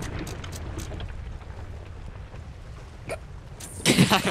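high, wordless fit of laughter; long real laugh. Real, not acted. Player cracking up at crewmates' antics, long laugh. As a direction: laugh on and on helplessly.

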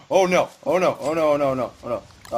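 rising panic, loud; frantic oh no; panicked. Real, not acted. Panic at a threat.

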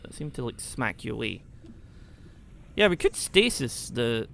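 ordinary talk, nothing marked

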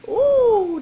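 high, loud delighted ooh. Real, not acted. Delighted at baby's feet.

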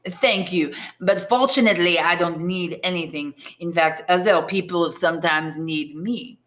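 put-on thick French Marseille accent, self-assured; heavy Marseille accent. Tailor declines Edmond's offer, heavy Marseille accent. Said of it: "in a heavy Marseille accent".

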